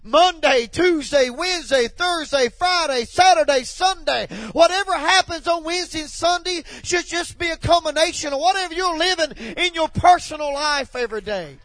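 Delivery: loud, high-pitched, punching out each word; fired-up preacher in full flow; full-force preaching. Real, not acted. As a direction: preach it at the top of your voice.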